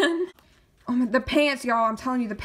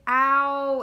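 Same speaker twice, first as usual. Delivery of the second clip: normal talking voice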